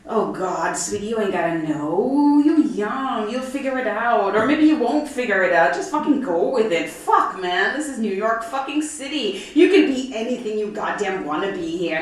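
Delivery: loud, high sassy queen advice; loud camp queen telling a shy girl to go with it; brassy drag queen. Retold or imitated. Drag queen voiced in story.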